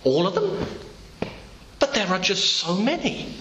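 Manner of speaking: incredulous gatekeeper. Pretend. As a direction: gasp at how many there are. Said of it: "the man looks at you and says".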